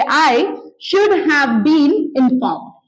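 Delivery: drawn out, word by word; slow model sentence. Shown as a demonstration. Teacher modelling the passive taunt slowly for the class. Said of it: "आप बस बोलेंगे".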